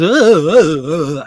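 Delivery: loud, mocking, wobbling wail; mock a piercing singing style with wordless wails; piercing vocal imitation. Mocking a singer who annoys him, piercing wails. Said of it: "the piercing, everything is".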